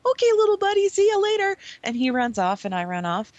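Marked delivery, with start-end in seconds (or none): 0.1-1.6 much higher and louder mock-sweet farewell; patronizing goodbye. Player waving off the little buddy, mock-sweet. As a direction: talk down to him sweetly. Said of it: "I'm like".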